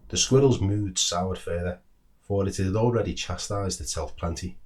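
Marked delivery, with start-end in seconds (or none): none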